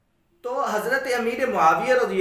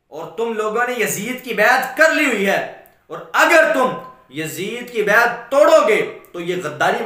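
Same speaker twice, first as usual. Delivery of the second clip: raised, insistent sermon voice; full-force preaching warning; 5 semitones higher, 7 dB louder than usual. Acted. Ibn Umar warning his family against breaking allegiance, forceful preaching. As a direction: drive the warning home to the listeners.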